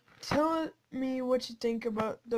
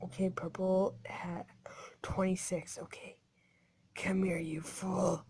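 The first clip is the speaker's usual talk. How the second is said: stalking whisper; 4 semitones lower, 12 dB quieter than usual. Acted. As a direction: whisper like a predator closing in. Sneaking after purple player.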